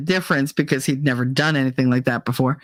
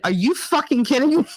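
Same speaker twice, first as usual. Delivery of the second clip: high-pitched mock outrage; retold indignation; 8 semitones higher, faster than usual. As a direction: pitch it up, incredulously mad. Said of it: "I was so mad".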